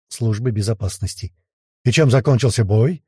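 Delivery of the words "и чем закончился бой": ordinary delivery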